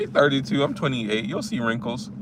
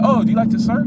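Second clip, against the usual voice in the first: put-on light girlish voice; 8 semitones higher, faster than usual. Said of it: "she'd be like".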